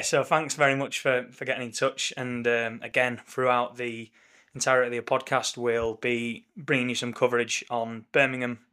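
normal talking voice

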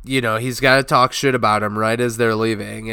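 ordinary talk, nothing marked

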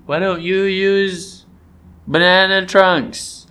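put-on high, slow kid voice; child voice. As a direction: ask it like a small child.

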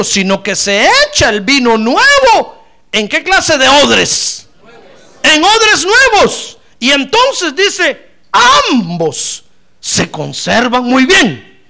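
proclamar fuerte y muy lento; prédica a plena voz. Acted. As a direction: alargar las palabras clave del versículo. (predicador gritando la Escritura)

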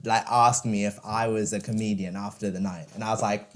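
normal talking voice

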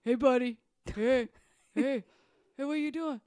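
high, loud excited pet-talk; voice for the dog. Shown as a demonstration. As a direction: egg on a dog with big high greetings. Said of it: "that voice that you do to him".

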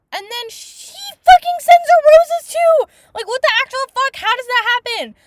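loud, high-pitched incredulous outburst; real outrage. Real, not acted. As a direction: explode in disbelief, high and very loud. Outraged rant at plot turn.

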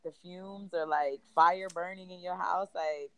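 ordinary talk

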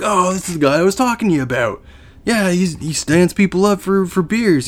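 high-pitched put-on friend voice; imitated Brian. Friend explaining the bum to the teller, imitated Brian. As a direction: imitate a pal casually explaining, pitched well up. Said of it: "Brian comes out. He's like".

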